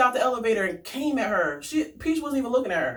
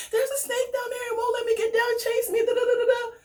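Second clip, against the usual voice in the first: mock crying child; 13 semitones higher than usual. Scared kid retells snake.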